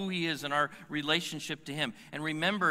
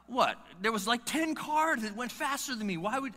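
higher, put-upon driver protesting the stop; mock outrage at being singled out; indignant protest; 8 semitones higher than usual. Pulled-over driver protesting to the officer, mock indignant. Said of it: "the first words out of your mouth".

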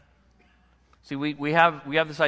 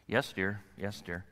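softer, distracted automatic reply; absent-minded yes dear; 6 semitones lower, 8 dB quieter than usual. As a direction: mumble it without listening. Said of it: "I can look her in the face".

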